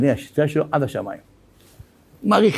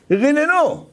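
high ringing call to the crowd; 11 semitones higher, much slower than usual. Real, not acted. Preacher calling out to his congregation, high ringing call. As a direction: rouse them with one sung-out word.

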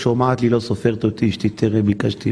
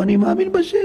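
high-pitched mocking claim of faith; hollow declaration of faith, voiced much higher; mock proclamation. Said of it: "אנשים יצעקו".

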